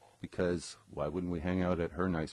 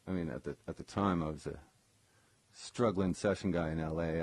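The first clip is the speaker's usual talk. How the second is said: ordinary talk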